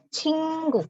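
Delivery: cinggu said slowly with an n sound; Korean word demo. Shown as a demonstration. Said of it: "seolah-olah ini huruf N". (ucapkan cinggu perlahan)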